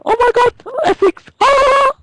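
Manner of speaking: very high, loud squeal; mocking camp voice. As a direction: send up her accent with a shriek. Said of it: "You just sound like a ca".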